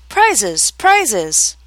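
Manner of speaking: jumbled clamouring voices; confused calling out. Acted. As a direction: call out over each other. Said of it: "calling out in a confused way".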